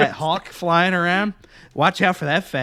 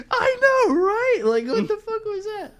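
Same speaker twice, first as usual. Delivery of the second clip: very high giggling voice; hysterical laughing. Retold or imitated. Agreeing with the wife, high and laughing. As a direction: gasp it out through laughter. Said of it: "I'm like".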